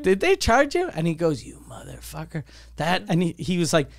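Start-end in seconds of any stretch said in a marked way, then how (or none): 0.0-0.9 high, tentative retold question; sheepish asking. Guest asks friend about the bar bill, sheepish asking. 1.4-2.4 quiet mock resentment. Friend stung by the bill, quiet mock resentment.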